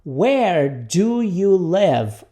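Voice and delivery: very slow, every word stretched; repeat-after-me model. Shown as a demonstration. Teacher introduces the question to students, very slowly. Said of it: "Repita comigo".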